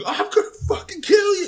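high, loud mock scream; Bobby screaming. Podcaster acts out Bobby threatening James, screaming. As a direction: imitate a teenager's screamed threat. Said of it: "Bobby is screaming".

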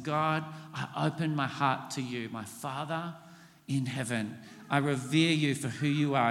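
a gentle personal prayer. Shown as a demonstration. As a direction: speak to God as a child to a father. Voices an example prayer.

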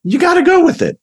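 eager high echo; emphatic echo. Real, not acted. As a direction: echo it with big gusto. Echoing emphatically.

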